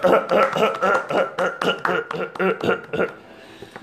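wheezy coughing laugh; loud hacking laugh; Seth Rogen laugh. Acted. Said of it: "you got the Seth Rogen laugh".

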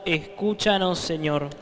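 ordinary talk

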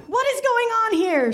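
high, startled demand; wife walking in on it; shocked outburst. Acted. Miranda bursts into the room.

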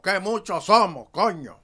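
put-on old catchphrase shout. Recalling the show's old catchphrase, shouted. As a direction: belt out the old radio line. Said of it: "nosotros decíamos".

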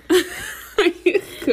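laughing through the words; giggling apology. Real, not acted. Phone falls, both laughing.

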